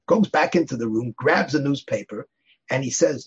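ordinary talk, nothing marked

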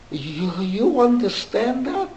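put-on a German accent, asked slowly; slow, German-accented question. Old German man on the train asking in a German accent. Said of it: "with a German accent".

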